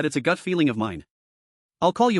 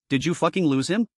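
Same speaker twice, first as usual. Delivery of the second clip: ordinary delivery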